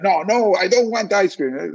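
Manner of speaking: high, drawn-out mock denial; acted denial. Acting out denial of craving.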